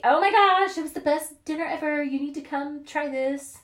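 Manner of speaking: high-pitched, excited mock post; gushing rave. Raving to social media followers about dinner, gushing.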